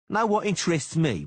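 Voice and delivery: high, loud mocking thug; taunting sneering bodyguard; Cicada voice.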